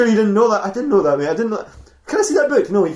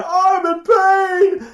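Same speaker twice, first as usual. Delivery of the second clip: high, very slow agonized wail; hammed-up beast howling in pain; creature in pain; 10 semitones higher, much slower than usual. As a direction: drag it out like a monster in agony. Lion creature screams in pain.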